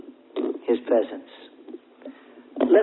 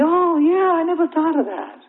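high-pitched dawning "oh, yeah"; pleased discovery, pitched up; sudden realization; 11 semitones higher than usual. Acted. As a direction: act out an idea just landing. Acts out sudden bright realization.

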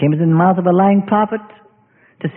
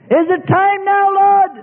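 high, whiny pleading voice; high whining plea to the Lord; impatient believer; 10 semitones higher, slower than usual. Acted. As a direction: nag like a child in the fire. Dialogue with God, believer whining.

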